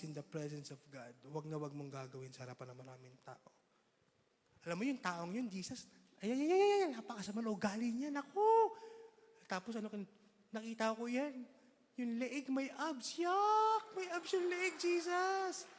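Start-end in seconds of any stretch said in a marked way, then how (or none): 5.5-15.7 high shrieking gossip to Jesus; gossiping churchgoer. Acted. Gossip complaining to Jesus about a man, high and disgusted.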